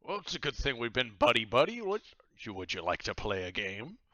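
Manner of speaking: high, loud jovial pirate captain. Acted. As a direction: boom it out like a cheery pirate host. Slams tankard, captain speaks.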